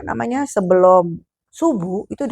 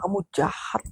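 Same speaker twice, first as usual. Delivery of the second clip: whispered, softer accusation; angry whisper; 8 dB quieter than usual. Retold or imitated. Wife whispers angrily at her husband on the road. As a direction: hiss the blame under your breath.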